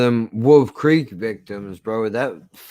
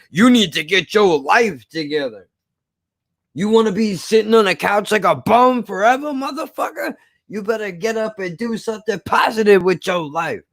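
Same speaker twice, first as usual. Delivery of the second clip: higher, forceful mock Mr. T pep talk; bassy motivational tough guy; 7 semitones higher than usual. Motivational speaker ordering a couch bum up, bassy mock tough-guy bluster. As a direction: imitate a hard-driving motivational speaker. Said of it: "with a little bit of bass in his voice".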